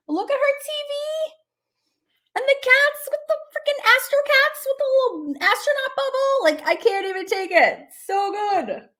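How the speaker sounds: squealing with delight, way up high; excited high squeal; gushing. Real, not acted. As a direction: gush over the cute cats. Gushing over a cute drawing.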